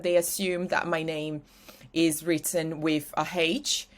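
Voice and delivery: normal talking voice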